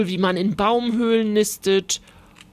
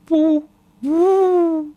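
high, loud hooting wail; sobbing owl hoot; 9 semitones higher, 6 dB louder than usual. A narrator's reading. Starling failing to find his own song, sobbing owl hoots. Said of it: "schluchzte der Star".

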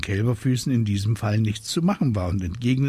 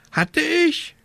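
very high squawked question; croaking raven; 4 dB louder than usual. A narrator's reading. Raven startled by the witch's answer, croaked question. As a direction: croak it back in disbelief. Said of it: "krächzte Abraxas".

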